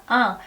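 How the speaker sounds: 앙 said as a nasal vowel; nasal ending 앙. Shown as a demonstration. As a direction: hum 앙 through the nose. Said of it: "콧소리 맞습니다".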